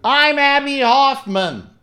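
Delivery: huge, slow, high full-throated yell; shouted at the top of lungs. Retold or imitated. Abby standing in the boat shouting to the river, full-lung triumphant yell. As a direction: bellow it out across the river like a release. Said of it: "at the top of his lungs shouts".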